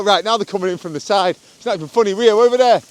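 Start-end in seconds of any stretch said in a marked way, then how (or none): 2.1-2.8 loud, high urgent call; calling the dog. Real, not acted. Owner warns his dog of a car, loud urgent call. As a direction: get the dog's attention now.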